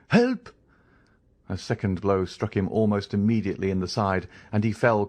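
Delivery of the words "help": sudden high, loud cry for help. Acted. Caderousse struck in the back, crying out loud. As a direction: scream it as the blow lands.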